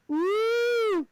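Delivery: high, sustained woo; long high cheer; excited whoop. Real, not acted. Cheering a remembered bicycle kick.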